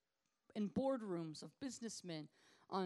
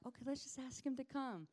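gentle; 6 semitones higher, 3 dB quieter than usual. Retold or imitated. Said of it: "I'm very gentle".

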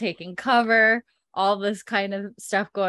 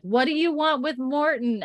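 mock yell; 7 semitones higher than usual. Roger to the men shooting, mock yelling. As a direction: call it out as a challenge. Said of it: "like, yells".

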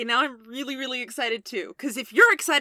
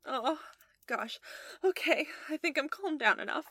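ordinary talk, nothing marked

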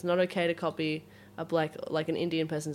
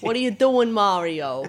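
put-on loud, hammy Italian-American accent; put-on Italian accent, pitched up then dropping; New York Italian accent; 3 semitones higher, 9 dB louder, slower than usual. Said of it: "I definitely talk in that accent".

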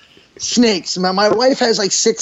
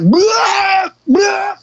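retching noise; 12 semitones higher than usual. Retold or imitated. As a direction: imitate throwing up, hammed up. Imitating vomiting from the allergic reaction.

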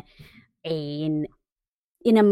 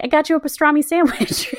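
[name] voice; 9 semitones higher than usual. Retold or imitated. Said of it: "[name] literally shows up and is like".

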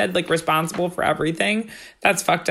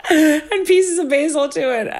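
high, laughing, rising excitement; excited friend quote; 5 semitones higher than usual. Retold or imitated. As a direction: pitch climbs through giddy laughter. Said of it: "they were like".

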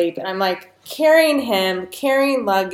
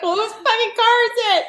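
very high, fast retort through laughter; retold bewilderment breaking into laughter; laughing exasperation; 13 semitones higher, much faster than usual. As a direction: squeal the question while cracking up.

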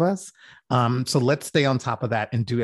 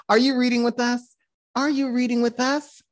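high sing-song teasing question; 11 semitones higher than usual. Real, not acted. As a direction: tease her, high and playful.

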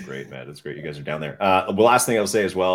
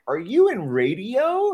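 high, slow questioning voice; curious stranger; 14 semitones higher, 4 dB louder, slower than usual. Retold or imitated. Podcaster imitating strangers asking about his voice, high. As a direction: ask it wide-eyed and slow. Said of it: "people were like".

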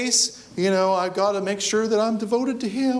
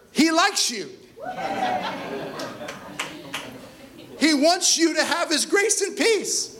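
laughing; 9 semitones higher than usual. Real, not acted. Joyful punchline to congregation.

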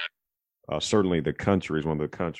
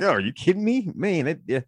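lightly mocking, much higher-pitched billionaire voice; a hint of a smug tech mogul, pitched up; Bill Gates impression; 12 semitones higher than usual. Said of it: "I don't do a real good Bill Gates impersonation".

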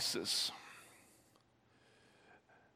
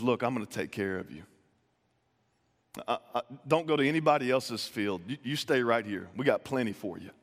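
lower, softer, gentle promise; soft reassurance; 5 semitones lower, 3 dB quieter than usual. Retold or imitated. Boaz telling Ruth to stay in his field, gently reassuring. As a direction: quietly promise to look after her.

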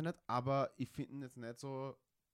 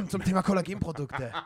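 laughing; 6 semitones higher, 9 dB louder than usual. Real, not acted. Rereads question, breaking up.

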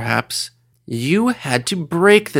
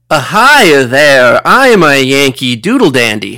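put-on Midwestern accent; 7 dB louder than usual. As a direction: lay on a hammy Midwestern American accent, loud. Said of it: "a quintessential, you know, Midwestern accent".

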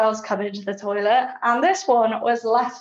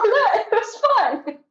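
laughing it off; 12 semitones higher, much slower than usual. Real, not acted. Laughing about toilet makeup.

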